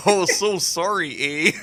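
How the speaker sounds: put-on hammy Canadian accent, slow; drawn-out mock Canadian accent. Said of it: "doing the stupid, uh, Canadian accents".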